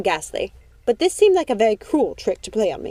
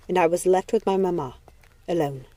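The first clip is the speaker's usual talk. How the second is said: plain reading voice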